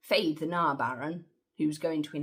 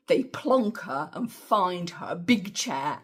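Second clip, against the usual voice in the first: ordinary delivery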